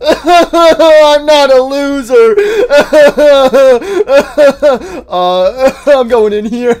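loud, high mock sobbing; exaggerated crybaby wail; hammed-up bawling. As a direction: wail like a hurt child between fake sobs. Called a loser, wailing.

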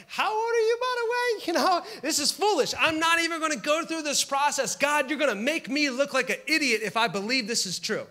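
nosy onlookers. Retold or imitated. Strangers mock Sarah's late pregnancy, high pitched mocking voice. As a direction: squeak it like a gossiping stranger. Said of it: "people are gonna be like".

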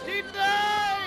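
very high-pitched shout; alarmed yell. Real, not acted. Player shouts news of a death, alarmed yell. Reporting death during escape.

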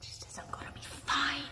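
hushed whisper. Real, not acted. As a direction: whisper it under the breath. Relaying spirit message.

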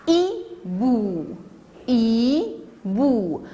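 "hibou" split into i, bou; syllable by syllable. Shown as a demonstration. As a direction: say "hibou" as two long separate beats. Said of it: "Combien y a-t-il de syllabes".

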